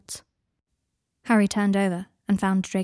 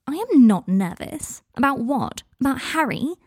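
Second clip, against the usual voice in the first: defensive; 5 semitones higher, 4 dB louder, slower than usual. Acted. Adelaide defensive denial.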